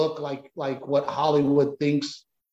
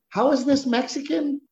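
a pitched-up scoffing question, much higher; mock incredulous exec; 11 semitones higher than usual. TV exec scoffing at his sitcom pitch, mock incredulous. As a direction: mimic the studio exec's disbelief.

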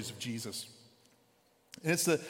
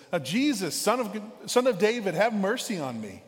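higher and louder, a desperate beggar crying out; louder yell; 5 semitones higher, 6 dB louder than usual. Retold or imitated. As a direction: call out louder so he can't be ignored. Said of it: "so he gets louder".

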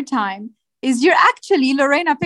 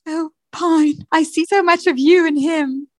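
higher, sighing romantic voice; swooning lover; 6 semitones higher than usual. Acted. Apple sighing over Pine in a higher voice. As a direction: sigh it like a soap heroine.

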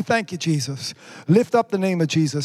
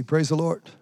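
ordinary delivery

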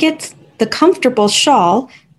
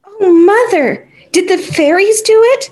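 excited cry; 9 semitones higher than usual. A narrator's reading. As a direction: gasp it out in wonder. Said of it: "cried Tilly".

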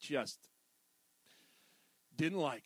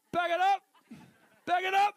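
much higher, much louder shout; 10 semitones higher, 8 dB louder than usual. Retold or imitated. Attendant shouts at a driver watching the camera, much higher and louder. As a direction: bellow at the car to reverse. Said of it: "So I was like".

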